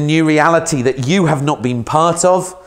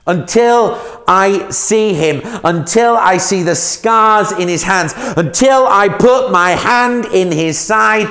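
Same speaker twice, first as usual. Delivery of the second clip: higher, louder, slow and bitter; venomous defiance; 7 semitones higher, 4 dB louder, slower than usual. Acted. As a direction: spit each until out like venom. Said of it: "he spits out some venom".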